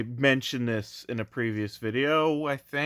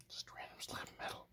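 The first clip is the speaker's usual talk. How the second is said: whispered aside; 23 dB quieter, faster than usual. Real, not acted. Dry aside about the keychain.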